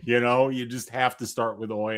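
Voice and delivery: normal talking voice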